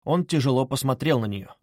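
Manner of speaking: plain reading voice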